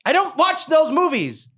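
high, loud, drawn-out objection; exasperated protest. Real, not acted. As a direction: protest it loudly, stretching the words. Protesting the suggested accent reference.

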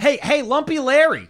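high, loud shout to a shipmate; calling out. Pretend. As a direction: call across the deck. Calling out to crewmate.

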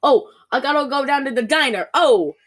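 mock an awful Jersey accent, loud final "Oh"; Stephanie Plum. Co-host mocking the actress's accent that comes and goes, hammed-up Jersey. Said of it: "Sometimes she'll be like".

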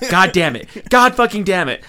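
hammed-up grumbling at the script; mock frustrated cursing.

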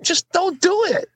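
exasperated. Retold or imitated. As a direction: plead with a friend who keeps doing this. Replying to friend's apology text.

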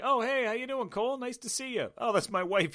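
high, chirpy mock greeting to an old pal; squeaky fake-cheerful hello; mock buddy greeting. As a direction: ham up the overly friendly buddy. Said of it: "his buddy's like".

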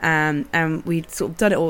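usual speaking voice

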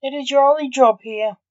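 high and loud, short and sharp; curt. Acted. Orderly curtly pressing the doctor, high and loud. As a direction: cut him off curtly. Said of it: "said the orderly curtly".

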